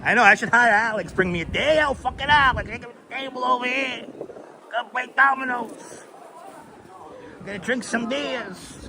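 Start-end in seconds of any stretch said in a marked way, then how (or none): 1.8-8.8 Bronx Puerto Rican. Acted. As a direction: put on the high Bronx Puerto Rican guy. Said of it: "Very few people can do that voice".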